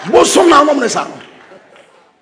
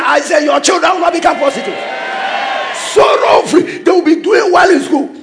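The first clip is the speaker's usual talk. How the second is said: preaching at full force; 9 semitones higher than usual. Real, not acted. Preacher shouting blessings over the church. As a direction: declare it over the congregation.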